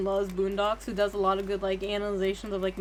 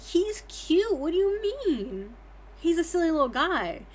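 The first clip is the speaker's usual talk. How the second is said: gushing defence; 6 semitones higher than usual. Retold or imitated. Retold defending crush on Kiryu.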